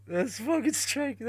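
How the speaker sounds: normal talking voice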